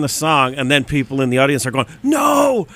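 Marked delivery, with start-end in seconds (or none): 2.0-2.7 shout. Retold or imitated. Audience shouting No.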